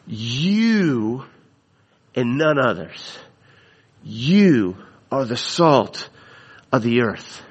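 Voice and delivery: You, you said apart, each You held and pressed; stress on You. Shown as a demonstration. Preacher voicing Jesus, hammering the word You. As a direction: lean on each You, then and none others. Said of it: "that you is an emphatic".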